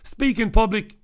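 normal talking voice

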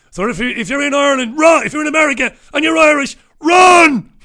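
high, loud warning shout; mock alarm. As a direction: holler a mock warning, building to a yell on the last word. Mock warning, calling out to listeners.

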